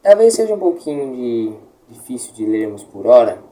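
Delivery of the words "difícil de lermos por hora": ordinary talk, nothing marked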